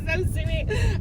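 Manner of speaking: ordinary talk, nothing marked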